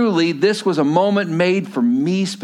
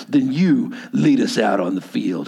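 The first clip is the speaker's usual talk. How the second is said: lower, calm team captain; 9 semitones lower than usual. Retold or imitated. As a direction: give the order low and steady. Captain voiced in retold movie.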